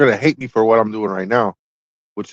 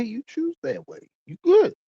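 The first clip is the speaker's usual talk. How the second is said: mock sing-song; 13 semitones higher than usual. Real, not acted. Mocking sing-song reassurance.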